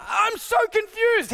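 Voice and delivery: high, slow baffled wail; mock confusion. Pastor reacting to insults, high slow baffled wail.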